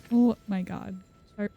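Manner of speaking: ordinary talk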